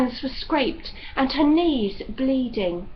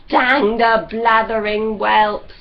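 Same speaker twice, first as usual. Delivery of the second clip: slow, louder gruff curse; spitting curse; 7 dB louder than usual. A narrator's reading. Judd spitting at the dog, a loud slow curse. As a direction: spit the words out in disgust.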